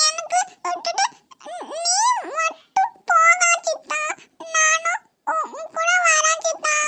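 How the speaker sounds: put-on high, slow childlike pleading; a small girl begging not to be left behind; pleading little girl. Niece begs her uncle to take her along.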